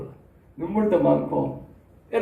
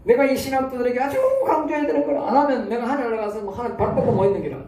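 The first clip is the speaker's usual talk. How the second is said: ordinary talk, nothing marked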